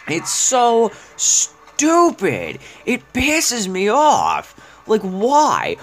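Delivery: whiny drawn-out complaint. Real, not acted. Ranting about a hated map.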